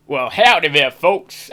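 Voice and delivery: hammed-up American howdy; caricature American accent. Shown as a demonstration. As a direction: put on a cartoonish American accent. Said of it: "it's a bit caricature American".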